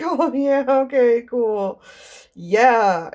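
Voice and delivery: loud, high acted enthusiasm; hammed-up awkward cheer; overeager agreement. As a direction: play up a too-keen reply, loud and lifted. Acted awkward reply to being recognized.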